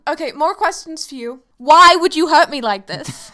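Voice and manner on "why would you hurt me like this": mock hurt. Mock protest at a surprise.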